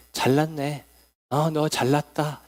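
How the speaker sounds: sarcastic mock compliment; reproachful jab. Shown as a demonstration. Preacher shows how changed intonation makes praise blame, sarcastically reproachful. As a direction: turn the praise into a put-down. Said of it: "칭찬이 아니라 책망의 말이나 비난의 말처럼".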